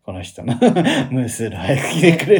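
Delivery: half-laughed inner monologue; laughing inner plea. Retold or imitated. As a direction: giggle desperately at the awkwardness. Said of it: "と思って".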